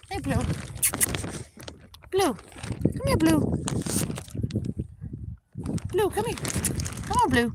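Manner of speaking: high sing-song coaxing; coaxing call to a goose. Real, not acted. Owner coaxing her goose Blue over in high baby talk. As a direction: lure the bird over in a high cooing voice.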